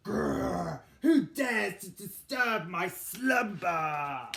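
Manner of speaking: slow, loud monster roar; growly beast voice. Acted. Woken jungle beast roaring at the noisy birds. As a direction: boom it out like a grumpy woken beast. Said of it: "The dreaded jungle beast".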